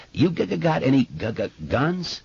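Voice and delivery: put-on boy stammering on first sounds; stuttering. A narrator's reading. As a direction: catch on each first consonant. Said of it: "Did you notice that he stutters?".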